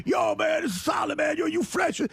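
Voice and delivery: mock hip-hop talk, lightly voiced; imitated low-voiced wigger. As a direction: lightly put on a slangy character voice. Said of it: "He's got a really low voice".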